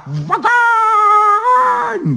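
very high, long-held shriek; beside herself. A narrator's reading. Baba Yaga orders Ivan burnt, shrieking wildly. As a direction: shriek it and hold the vowel. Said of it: "Вовсе зашлась Яга".

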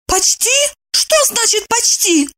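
high-pitched startled demand; shocked. A narrator's reading. Evdokia, clutching her heart, questions her friend, shocked. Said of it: "Она схватилась за сердце".

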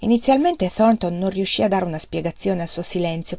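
usual speaking voice

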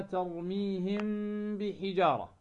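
ترميهم بحجارة with the m held as a hum into the b; ikhfa of the m. Shown as a demonstration. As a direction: slowly draw out the m of ترميهم before بحجارة. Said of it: "وتكون طريقة النطق بها". (إخفاء ميم ترميهم عند الباء مع الغنة)

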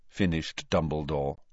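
plain reading voice

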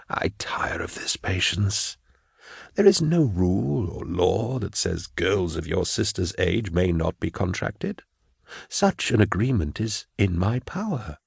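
soft, measured authority; quiet official, tired of argument; weary, controlling prefect. Acted. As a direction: assert power quietly, without hurry. Prefect asserts his power.

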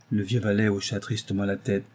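ordinary delivery

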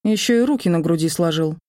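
usual speaking voice

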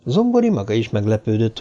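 ordinary delivery

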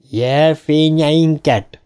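slow, drawn-out mock indignation; offended exclamation. A narrator's reading. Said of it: "kiáltotta Ricci sértődötten". (sértődött, elnyújtott felkiáltás)

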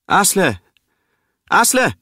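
a woman calling a name across the water, high and loud. Acted. A woman on the shore calling for Asle, loud call. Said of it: "hört sie eine Frau rufen".

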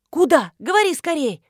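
high, loud, impatient burst; urgent prodding. A narrator's reading. Marina hurrying the narrator to answer, urgent prodding. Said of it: "поторопила меня Марина".

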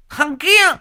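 high, loud jealous screech falling on "kia"; jealous woman repeating "Thằng kia!" in a rage; furious shriek again. Acted. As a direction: scream it down at him. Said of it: "sấn sổ".

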